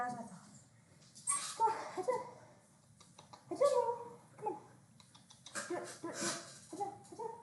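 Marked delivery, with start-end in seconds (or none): 1.6-7.3 high coaxing pet voice. Real, not acted. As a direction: sweet-talk a puppy. Coaxing dog.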